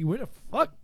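normal talking voice

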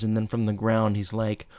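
ordinary talk, nothing marked

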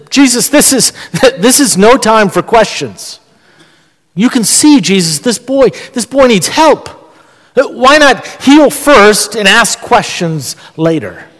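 puzzled, urgent challenge, lightly acted; perplexed objection. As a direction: plead that there's no time to waste. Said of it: "I'm a little bit perplexed".

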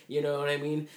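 ordinary delivery

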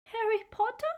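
very high, quivering house-elf voice; small, timid, high-pitched creature; tiny squeak. A narrator's reading. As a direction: squeak it like a petrified little elf. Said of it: "he squeaked in the tiniest quiver of a voice".

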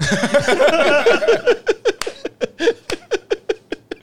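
big high burst of laughter; loud laugh. Real, not acted. Host laughs at joke.

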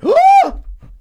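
sharp, high, loud mock gasp; shocked gasp. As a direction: gasp as if scandalised. Mock shock at Goonies line.

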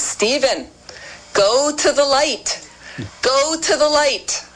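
high, slow Long Island mother; Long Island accent. Retold or imitated. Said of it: "in her lovely Long Island accent".

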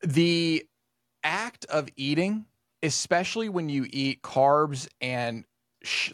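slow, measured explanation with pauses; slow explaining. Retold or imitated. Therapist explains eating and dopamine to client, slow and measured. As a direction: lay it out piece by piece, slowly.

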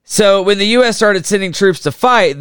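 usual speaking voice

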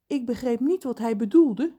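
usual speaking voice

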